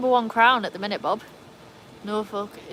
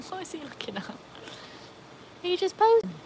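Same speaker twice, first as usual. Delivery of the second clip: amused pet voice; 10 semitones higher than usual. Real, not acted. Laughing at posing dog.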